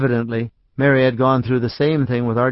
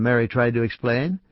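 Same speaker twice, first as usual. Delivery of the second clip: plain reading voice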